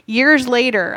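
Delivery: usual speaking voice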